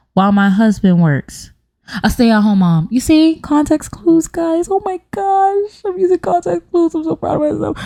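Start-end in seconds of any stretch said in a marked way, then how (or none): 2.9-7.8 high-pitched gushing; squeaky, hammed-up self-congratulation; mock self-pride. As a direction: coo up high, proud of yourself. Gushing praise of herself.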